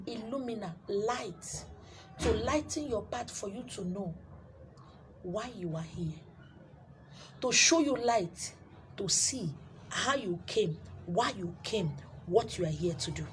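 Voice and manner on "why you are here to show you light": usual speaking voice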